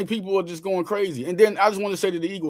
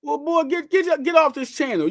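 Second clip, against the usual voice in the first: high, scolding call to get off the channel; mock scolding; 10 semitones higher than usual. Host scolding Cool Cat to get off the channel, mock stern. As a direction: shoo him off like a fed-up parent.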